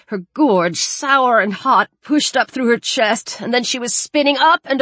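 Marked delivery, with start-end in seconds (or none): none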